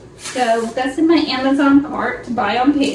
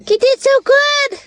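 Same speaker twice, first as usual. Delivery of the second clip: much higher sing-song praise for the dog; 11 semitones higher than usual. Real, not acted. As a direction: gush over the puppy in a high voice. Praising dog after vet.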